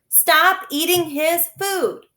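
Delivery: loud, slow, high furious demand; boy shouting at the doctor to stop eating; angry shout. Acted. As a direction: order him to stop, furious. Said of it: "his face contorted in anger".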